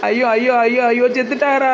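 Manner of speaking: sudden crying shock at the news; shocked wailing cry. Acted. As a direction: burst into a shocked wail. Shocked wail at the death news.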